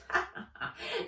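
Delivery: high breathy cackle; elf cackle. Acted. Elf cackling at Nat, high and wicked. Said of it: "The elf cackled".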